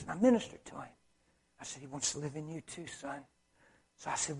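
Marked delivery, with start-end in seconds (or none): 1.8-3.2 very soft and low, almost under the breath; hushed ministering. Retold or imitated. Preacher retelling how he ministered to a young man, hushed. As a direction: gently confide it.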